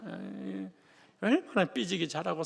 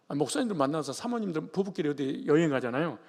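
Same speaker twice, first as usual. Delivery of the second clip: usual speaking voice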